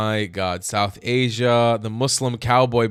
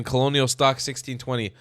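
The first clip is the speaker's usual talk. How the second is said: ordinary delivery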